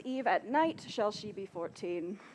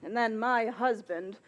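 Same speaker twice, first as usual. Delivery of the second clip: ordinary talk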